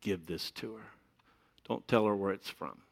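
softly, secretive; a hint of a hushed aside; hushed instruction. Friends secretly pass on a necklace, hushed. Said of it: "and said".

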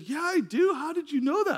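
high-pitched mock astonishment; astonished reply. Woman amazed the preacher knows her pain, astonished.

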